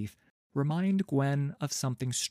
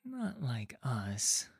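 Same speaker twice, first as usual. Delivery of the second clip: put-on slow, whispery boy's mutter; muttered under breath; 5 dB quieter, slower than usual. A narrator's reading. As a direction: whisper it dejectedly to yourself, drawn out. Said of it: "he mutters under his breath".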